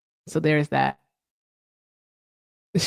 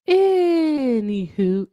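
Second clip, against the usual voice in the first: drawn-out anywho; 6 semitones higher, much slower than usual. Real, not acted. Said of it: "drawn-out filler".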